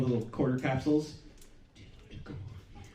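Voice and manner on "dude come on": unvoiced breathy kid complaint; whispered whine. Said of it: "daughter complaining next morning".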